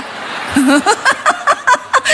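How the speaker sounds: long high-pitched laugh. Real, not acted. Pastor laughs at her own punchline, high laugh.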